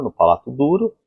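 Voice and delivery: ordinary talk, nothing marked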